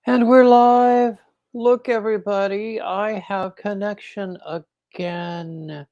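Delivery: high, excited call that opens loud; excited live announcement. Real, not acted. Host greets livestream viewers after connection returns, excited announcement. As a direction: announce it in a high, celebratory voice, drawing out the end.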